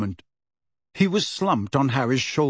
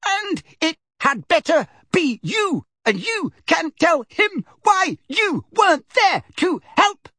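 put-on old lady yelling furiously. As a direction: yell it while swinging the bag. Figg still yelling.

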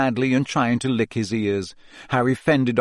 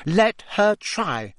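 slow, pitched-up defiance; defiantly; 8 semitones higher, slower than usual. A narrator's reading. Hermione answering Ron's warning, slow and defiant. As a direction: dare her, drawing it out. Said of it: "said Hermione defiantly".

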